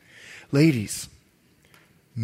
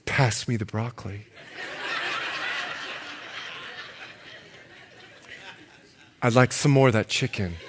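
mock seductive wife; 5 semitones lower, 7 dB quieter than usual. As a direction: lower and softer, playfully sultry. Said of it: "and she does this".

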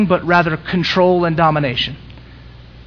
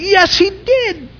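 much higher, ringing preaching; impassioned preaching; 13 semitones higher, 4 dB louder than usual. Acted. Pastor answers the critics, high ringing preaching. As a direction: soar on the yes.

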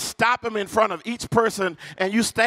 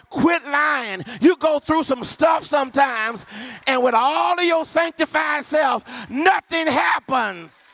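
high, ringing church preacher cadence; rousing call to the congregation; full-force preaching; 5 semitones higher than usual. Real, not acted. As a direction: preach it out, stretching the key words.

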